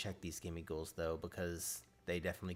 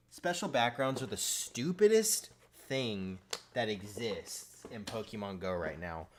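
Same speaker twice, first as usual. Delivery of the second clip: usual speaking voice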